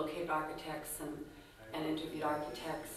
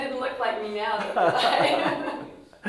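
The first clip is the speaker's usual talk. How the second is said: laughing; 6 semitones higher, 11 dB louder than usual. Real, not acted. Laughing at old photo.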